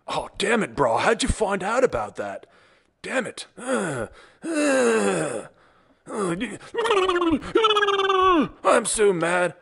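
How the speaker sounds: high, strained mock fury; hammed-up frustration. Supply clerk foiled by the informed patient, mock fury. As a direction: sputter like a cheated clerk.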